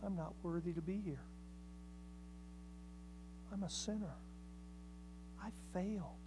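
soft, hushed confession, fast at first; humble sinner. Acted. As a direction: murmur it like a broken man asking mercy. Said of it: "The second man said".

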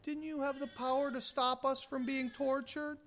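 a hushed complaint the martyrs never made; soft whining plea. Retold or imitated. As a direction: murmur it like a self-pitying prayer. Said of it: "They didn't say".

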